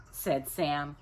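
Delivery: usual speaking voice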